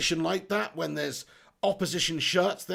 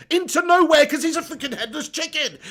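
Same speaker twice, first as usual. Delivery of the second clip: angry outburst; 7 semitones higher, 6 dB louder than usual. Real, not acted. Fan watch-along, angry rant.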